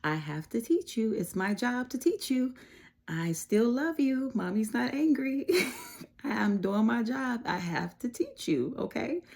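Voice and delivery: put-on high, tender voice reassuring her child; gentle mom reassurance. Mother reassuring her daughter after correcting her, high and tender. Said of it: "this is me".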